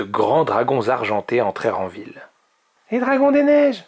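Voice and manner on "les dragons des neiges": put-on very high, excited young dragon; high-pitched burst of amazement; awed exclamation. A narrator's reading. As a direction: gasp it out high and loud. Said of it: "souffla Oria".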